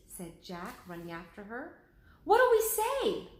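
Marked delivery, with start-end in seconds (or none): 2.2-3.3 high, slow worried cry; panicky, unsure what to say; anxious question. Acted. Jack asks Annie as they run: high anxious question.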